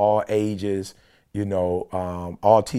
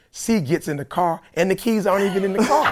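higher, laughing in disbelief; 11 semitones higher than usual. Real, not acted. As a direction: tell it while cracking up. Laughing retelling of missing keys.

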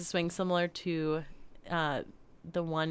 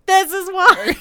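wry punchline; 13 semitones higher, 14 dB louder, slower than usual. Retold or imitated. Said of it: "I was like".

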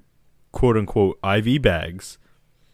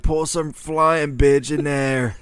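demanding patient voice; 5 semitones higher, slower than usual. Retold or imitated. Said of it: "I'm like".

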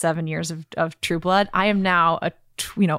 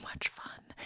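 near-whisper, drawn out; real hushed, whispery aside; hushed confiding; 12 dB quieter, slower than usual. Real, not acted. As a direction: confide it softly, almost all breath. Confiding love of low-paid stage work.

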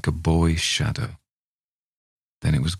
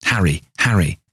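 mild mock shout out the window; calling out; 2 semitones higher, 3 dB louder than usual. Mother calls to the shadow in the garden, mild shout. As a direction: call a name into the garden, a touch louder. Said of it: "opened the window and shouted".

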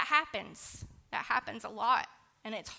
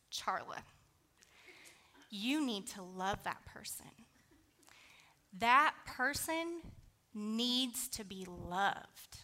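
softer, slow loving advice; gentle; 5 dB quieter, slower than usual. Retold or imitated. Grandma gently telling her granddaughter to love that person, soft and slow. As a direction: say it softly and slowly, like a wise grandmother.

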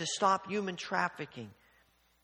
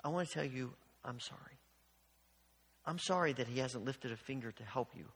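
soft, low, gently retold apology; tender, hushed reassurance; sincere apology; 4 semitones lower, 7 dB quieter, faster than usual. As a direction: speak quietly and kindly to someone worn down. Retold gentle apology to flustered waitress.

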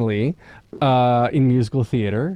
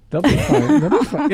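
They'll be fine tossed off higher and louder, laughing; mock breezy reassurance; 10 semitones higher, 3 dB louder than usual. Dad brushing off the kids for four weekends, breezy. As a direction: wave off worry about the kids brightly.

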